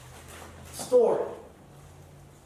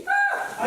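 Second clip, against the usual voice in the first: short, very high-pitched mock scream; acted scream; 7 dB louder than usual. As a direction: let out a loud, high shriek as the wife. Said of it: "Myra screams".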